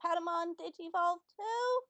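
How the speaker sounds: high, worried question; imitating concerned voice. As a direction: mimic a frightened little creature asking. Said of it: "He sounds like".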